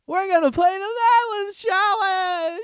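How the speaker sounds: high, slow, fake upbeat intro; mock a peppy YouTuber greeting; cheesy YouTuber voice. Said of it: "so fucking YouTubery".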